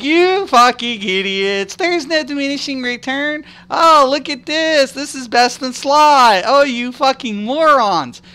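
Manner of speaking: high, slow, taunting sneer; drawn-out gloating mockery at the players; mocking gamers. Gloating over rival players, slow high mocking taunt. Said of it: "I'd be like".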